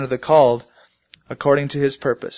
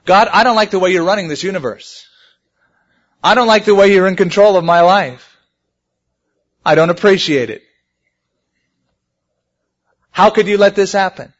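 mock complaint to God; 5 semitones higher, 6 dB louder than usual.

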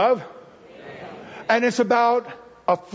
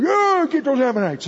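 higher, eager cheer of the crowd; mock crowd cheer; 7 semitones higher than usual. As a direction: cheer on the punishment like a rowdy crowd. Crowd cheering God's judgment.

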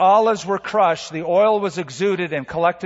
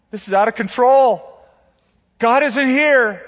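despairing cry; 9 semitones higher, 7 dB louder than usual. Pretend. Voicing a despairing believer.

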